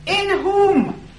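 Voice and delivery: "in whom" lifted high and loud; stress on in whom. Shown as a demonstration. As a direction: lean hard on "whom". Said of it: "I'm sure this is the way to read it".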